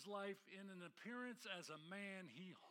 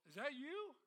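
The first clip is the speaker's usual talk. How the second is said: higher, louder, disbelieving ask; incredulous question; 8 semitones higher, 7 dB louder than usual. Retold or imitated. To the other McCracken, a loud incredulous question. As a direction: pitch it up in disbelief. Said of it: "and I go".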